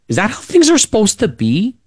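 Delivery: put-on high, wide-eyed questioning child; bewildered kid. Kid on the steps asks about the fight, high and bewildered. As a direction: ask it like a confused little kid. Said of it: "and you're going".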